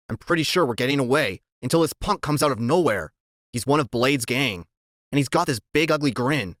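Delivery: ordinary delivery, nothing marked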